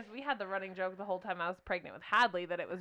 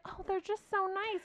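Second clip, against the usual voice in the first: gushing husband; 10 semitones higher, 5 dB quieter than usual. Retold or imitated. Husband praising sweet ladies.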